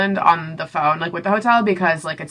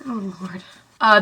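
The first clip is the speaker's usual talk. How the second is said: usual speaking voice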